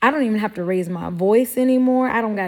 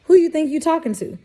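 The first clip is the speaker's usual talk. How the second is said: high, fast indignant snap; sassy retort; 7 semitones higher, faster than usual. Retold or imitated. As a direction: put them in their place, fast and high.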